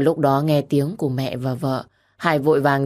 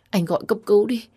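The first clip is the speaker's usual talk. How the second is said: high-pitched worried request; urgent plea; 6 semitones higher, faster than usual. A narrator's reading. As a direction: plead urgently, still held in. Begging husband to call ambulance. (khẩn khoản, lo lắng)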